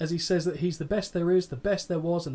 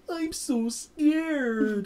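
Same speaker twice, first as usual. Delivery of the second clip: much higher, very slow, sing-song mock fear; mock scared Shawn; 9 semitones higher, much slower than usual. Host imitating Shawn taunting Bret, mock scared. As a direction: drag it out high like a sarcastic taunt. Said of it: "the exact tonality that he gets".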